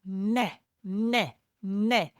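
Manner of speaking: tongue on the roof of the mouth, "neh"; gentle imitated baby hunger cry; hungry cry sound. Shown as a demonstration. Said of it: "Imagine you don't have any teeth".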